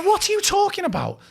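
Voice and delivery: high-pitched, loud mock outrage; indignant comeback. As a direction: fire it back as if affronted, voice leaping up. Imagined angry comeback continues.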